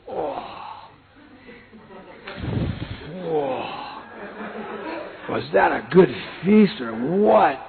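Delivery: slow, blissful sated sighing; satisfied brahmachari. Retold or imitated. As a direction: savour the memory of the feast. Brahmacharis voiced after feast.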